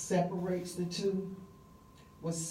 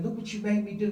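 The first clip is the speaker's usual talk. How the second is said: mock yell, a bit louder and higher; half-acted panicked shout; retold scream; 5 semitones higher, 4 dB louder, faster than usual. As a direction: throw it at her like an accusation. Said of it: "he starts screaming".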